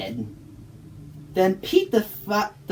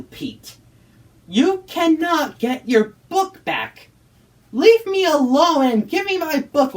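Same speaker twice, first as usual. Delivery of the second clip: angry and cross; 6 semitones higher than usual. Acted. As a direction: threaten the ghost with a kid's fury. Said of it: "very angrily and cross".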